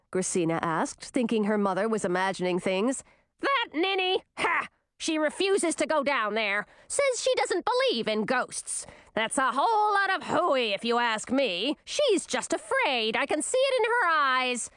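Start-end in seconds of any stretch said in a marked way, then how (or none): 3.4-14.7 high, sneering queen voice; scornful old queen. A narrator's reading. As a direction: sneer at your returning daughter.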